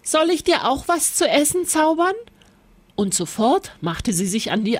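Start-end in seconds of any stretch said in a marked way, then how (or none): 0.0-2.1 high, eager little witch; bright asking girl, quick; Lisbeth. A narrator's reading. Lisbeth asks the witch to fix her broom, high and quick.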